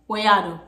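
"Wayado" in three quick syllables, way-a-do; Patois wayado. Shown as a demonstration. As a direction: toss out "Wayado" casually. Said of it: "what you doing is wayado".

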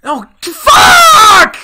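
shrieking, very high desperate wail; panicked pleading. Real, not acted. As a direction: beg at the top of your voice. Pleads with game rhino.

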